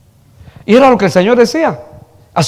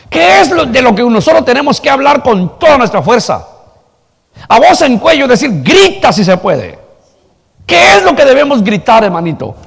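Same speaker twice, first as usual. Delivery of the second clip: fervent preaching; 5 semitones higher, 3 dB louder than usual. Acted. Preaching at full force.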